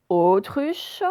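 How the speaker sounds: "autruche" with a long, drawn-out first O; accent sur le O. Shown as a demonstration. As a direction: stretch the opening O of "autruche". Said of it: "On l'entend le son O au début". (« autruche », le O initial bien allongé)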